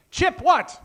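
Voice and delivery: normal talking voice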